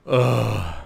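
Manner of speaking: loud, drawn-out "ugh"; exasperated groan. Real, not acted. Commentator fed up with his own joke, groaning.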